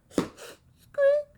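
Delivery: very high, loud squeak. A narrator's reading. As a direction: squeal like a tiny creature. Small creature's noise in story.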